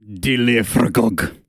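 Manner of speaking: slow, deliberate "Dilifragog"; guttural Elvish name. Shown as a demonstration. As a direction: draw out "Dilifragog" with throaty, guttural consonants. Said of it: "Gutturals from the throat".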